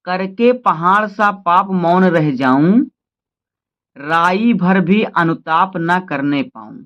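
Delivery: slow, solemn verse reading; poem recitation. Acted. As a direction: give each line weight as you recite.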